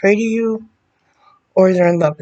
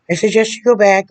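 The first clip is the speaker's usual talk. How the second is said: emphatic repeat; 3 semitones higher, 4 dB louder than usual. Real, not acted. Insisting he return to therapy, louder emphatic repeat. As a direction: hammer the advice home with a raised voice. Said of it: "repeats it louder".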